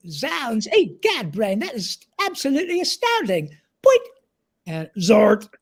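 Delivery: high, excitable cartoon mouse; Pinky voice. Shown as a demonstration. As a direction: squeak out Pinky's quirky noises. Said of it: "quirky noises that Pinky would make".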